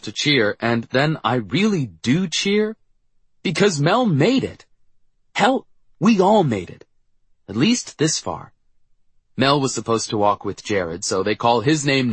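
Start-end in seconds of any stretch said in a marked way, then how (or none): 0.6-8.5 rising joy; elated. Acted. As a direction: cheer it out. Narrator cheering at graduation.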